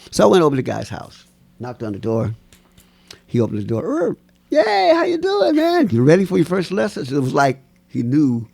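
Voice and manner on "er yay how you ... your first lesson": much higher, bright welcoming greeting; cheerful old teacher. Retold or imitated. The old tap dancer opening the door to his new student, much higher cheerful greeting. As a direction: greet him like you've been expecting him.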